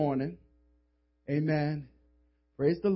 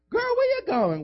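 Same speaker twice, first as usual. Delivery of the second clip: much higher, louder gossipy friend; high girlfriend voice; 4 dB louder than usual. Retold or imitated. Roommate calling out to friend leaving, high pitched. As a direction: squeal at her friend.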